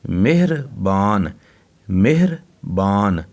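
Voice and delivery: मेहरबान said slowly, syllable by syllable, with a silent ह after मे; careful slow spelling-out. Shown as a demonstration. Teacher sounding out मेहरबान slowly for children. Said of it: "मिहर, ये हे के ऊपर ज-, जज़्म है".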